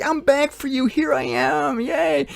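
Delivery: high, singsong mock-cheery voice; excited returning Jesus. Jesus announcing he's back to disappointed believers, excited. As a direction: pipe it up high like a giddy arrival.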